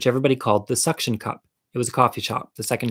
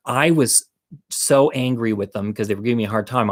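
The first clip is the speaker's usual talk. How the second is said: normal talking voice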